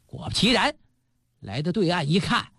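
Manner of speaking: ordinary delivery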